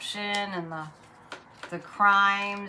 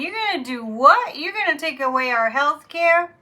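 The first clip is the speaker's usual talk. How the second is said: higher, louder mock outrage; imitating outraged citizens; 7 semitones higher, 7 dB louder than usual. People hit by storms to Congress, mock indignant. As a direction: voice the crowd's disbelief. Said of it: "are we all out there going".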